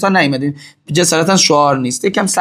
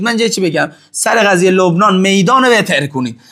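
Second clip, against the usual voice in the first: ordinary talk, nothing marked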